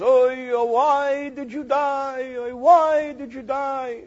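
slow, high mock lament; hammed-up graveside wail, drawn out slow. Mourner at a grave, wailing slowly in mock grief. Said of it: "he's crying buckets of tears".

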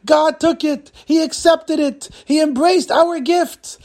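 high, drawn-out cries of triumph; joyful scream. Acted. Husband running home to tell his wife, screaming with joy. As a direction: proclaim it to the whole house, elated. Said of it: "he screams as he walks into".

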